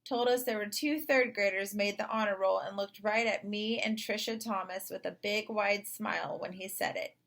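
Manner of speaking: usual speaking voice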